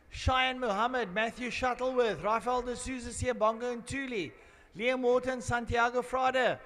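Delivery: loud, high trackside announcer calling names; grid announcement. Acted. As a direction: boom the grid out over the PA.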